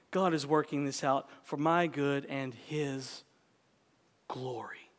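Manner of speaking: whispered glory at the end; stress on glory. Shown as a demonstration. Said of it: "emphasize different words in that sentence".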